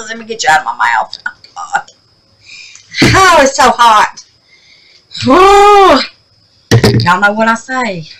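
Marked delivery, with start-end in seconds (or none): 1.3-1.9 whisper. Real, not acted. Overheated in the sun. 3.1-7.3 overheated. Real, not acted. Overheated in the sun.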